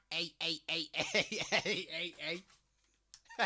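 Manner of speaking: loud, high repeated hey chant; cocky Scouser walk-in. Acted. Barman mimics the crab coming in, loud mocking heys. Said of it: "coming in all like that".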